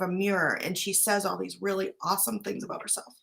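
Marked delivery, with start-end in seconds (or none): 2.4-3.1 words fading into a breathless whisper; real emotion choking the line off; tearful near-silent finish; voice breaking. Real, not acted. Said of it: "Getting emotional".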